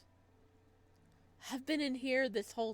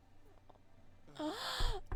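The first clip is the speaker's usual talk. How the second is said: sharp high gasp of alarm; startled high gasp; shocked gasp. Real, not acted. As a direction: gasp as if hit by bad news. Reacts to injured Mishka.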